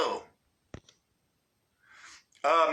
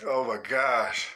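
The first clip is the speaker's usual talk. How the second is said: laughing to tears; 6 semitones lower, slower than usual. Real, not acted. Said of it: "I'm up here crying".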